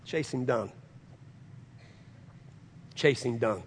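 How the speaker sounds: quiet echo. Acted. Quiet echo after climax.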